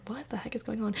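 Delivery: soft, breathless whisper; bewildered whisper. Retold or imitated. Dragged into the room, whispered confusion. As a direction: mutter it under your breath.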